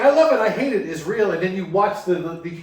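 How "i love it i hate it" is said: higher, louder flip between love and hate; mock kid reactions. Kids twisting the dial, mock excited. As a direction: swing from loving to hating it.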